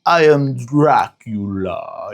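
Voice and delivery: drawn-out, very slow vampire count; slow Dracula voice. Retold or imitated. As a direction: stretch every word like the old Count. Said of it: "super slow in his Dracula voice".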